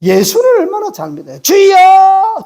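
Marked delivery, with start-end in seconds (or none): 1.4-2.4 high, long-drawn "Lord!"; mock prayer cry. Preacher mimicking believers calling on the Lord, loud drawn-out cry.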